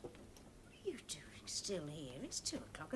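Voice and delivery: hushed late-night whisper; breathy whisper so nobody wakes. Real, not acted. Waking dad at 2am, whispering.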